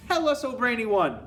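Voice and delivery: high, loud adoring plea; acted high-pitched crowd cry; eager cry. A narrator's reading. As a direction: implore the leader like an eager follower. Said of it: "they cried".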